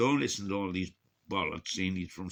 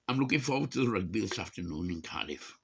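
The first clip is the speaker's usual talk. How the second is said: ordinary talk, nothing marked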